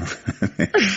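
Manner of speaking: a short, high chuckle; amused laugh. Acted. The inspector amused by the maid's remark, high chuckle. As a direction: laugh warmly at her answer.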